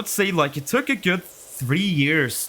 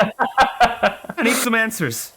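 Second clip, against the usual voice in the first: laughing demand; 6 semitones higher, much slower than usual. Real, not acted. Demanding mock serious.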